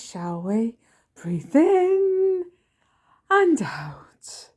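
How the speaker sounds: slow, sing-song calming guide; hammed-up soothing coach; mock mindfulness voice. Son parodying a wellbeing guide for mother, slow and exaggerated. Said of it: "He does that kind of a voice".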